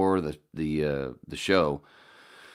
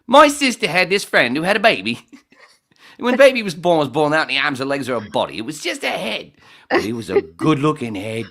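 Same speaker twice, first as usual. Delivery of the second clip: loud, high put-on comic voice; Rodney Roode impression; 10 semitones higher, 9 dB louder than usual. Comic doing Rodney Roode bit before the show, loud and high. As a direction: belt it out in a brash comic character. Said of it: "so I was doing Rodney Roode".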